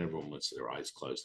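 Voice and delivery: ordinary talk, nothing marked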